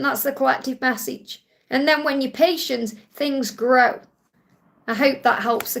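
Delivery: ordinary delivery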